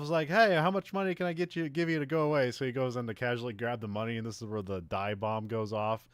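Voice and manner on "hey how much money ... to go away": high, loud mock-jokey villain; [name] joking. Villain jokes to himself about being bought off, playful imitation. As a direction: toss it out as a playful, high-pitched aside. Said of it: "kinda joking to himself".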